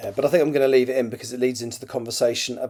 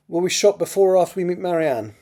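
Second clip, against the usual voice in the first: put-on higher, louder accented character voice; Mackenzie's accent; 8 semitones higher, 8 dB louder than usual. A narrator's reading. Mackenzie asks Crothers about meeting Marianne, in regional accent. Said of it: "I should try and do that as well".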